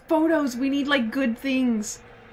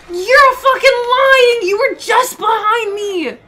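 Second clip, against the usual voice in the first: high, loud, slow wail of disbelief; stretched-out cry of shock; shocked yelling; 7 semitones higher, 10 dB louder, slower than usual. Real, not acted. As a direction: yell in outraged protest. Shocked by villain reappearing.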